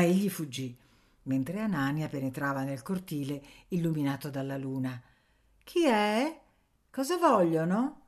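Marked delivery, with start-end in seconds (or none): 5.6-8.0 put-on much higher little girl's voice; a small girl calling from the shadow; thin little voice. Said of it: "una vocina sottile". (vocina sottile di bambina, molto più alta)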